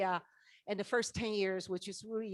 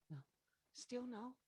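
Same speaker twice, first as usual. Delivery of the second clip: normal talking voice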